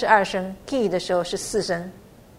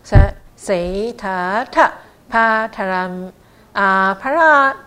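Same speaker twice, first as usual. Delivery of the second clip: loud, slow chanted syllables; long-vowel recitation; 6 dB louder, slower than usual. Shown as a demonstration. Teacher modelling long sounds for learners, loud and drawn out. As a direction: project it slowly, stretching the long vowels. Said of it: "帕拉又是一个长音".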